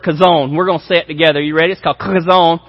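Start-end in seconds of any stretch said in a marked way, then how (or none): 0.0-0.6 kazon with a throaty k, stress on zon; Hebrew word. Shown as a demonstration. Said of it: "you say this word". 2.0-2.6 kazon with a guttural k and a long zon; Hebrew word. Shown as a demonstration. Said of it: "that's how you say it".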